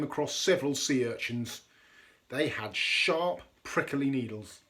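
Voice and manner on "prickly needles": ordinary delivery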